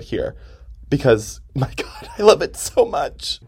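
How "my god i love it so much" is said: high, slow, laughing gush; giddy laughing. Real, not acted. Gushing over favourite bar.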